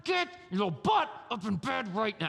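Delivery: a hint of a mom losing patience, voiced lightly; devil mom. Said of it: "Now you're gonna get devil mom".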